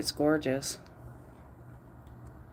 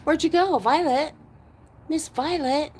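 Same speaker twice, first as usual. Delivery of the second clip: calling out; 7 semitones higher than usual. Real, not acted. Looking for lost dog.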